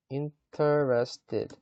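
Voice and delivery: very slow, syllable by syllable; careful demonstration. Shown as a demonstration. Teacher showing learners the right word, slow and syllable by syllable. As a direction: stretch out each syllable of "Interested". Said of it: "이렇게 해야 되죠".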